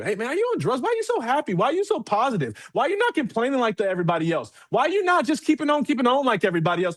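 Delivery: high, incredulous mock coworker; amazed coworker. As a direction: ask it like you can't believe how happy he is. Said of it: "what I want your coworkers to think".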